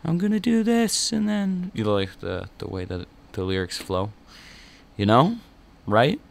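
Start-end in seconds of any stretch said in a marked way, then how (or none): 0.0-1.7 much higher, choppy sung-speak; staccato lyric imitation. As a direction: chop it up like punk lyrics. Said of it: "the kind of staccato".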